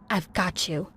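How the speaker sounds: low, soft menacing voice; creepy pursuer. A narrator's reading. Stalker to the girl hiding in the closet, quietly menacing. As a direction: drop low and quiet, as if cornering someone.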